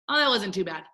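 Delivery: ordinary talk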